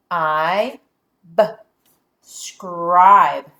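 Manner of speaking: I, b said as separate sounds, then scribe blended loudly; modelling blending for a class; sounding out. Shown as a demonstration. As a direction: stretch the sounds apart, then snap into scribe. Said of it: "let's read this syllable together".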